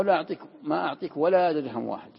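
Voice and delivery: a loud refusal with every word pressed, لا hit hard; emphatic refusal. Shown as a demonstration. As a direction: refuse outright, no room to argue. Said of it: "الثاني أقوى". (رفض قاطع بصوت عالٍ)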